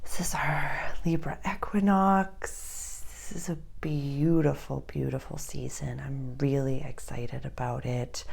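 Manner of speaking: soft, unvoiced whispered welcome; whispering. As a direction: breathe the words in a hush. Whispered opening greeting.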